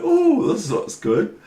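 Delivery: mock delight. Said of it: "And I'm going".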